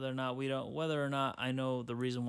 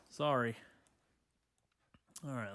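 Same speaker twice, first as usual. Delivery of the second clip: usual speaking voice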